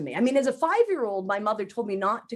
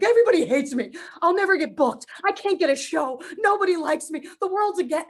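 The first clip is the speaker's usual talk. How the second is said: higher, louder self-pity spiral; catastrophizing whine; 6 semitones higher, 4 dB louder than usual. Retold or imitated. Retelling her old all or nothing thinking, higher louder mock despair. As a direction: wail that the whole world is against you. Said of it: "I'd be like".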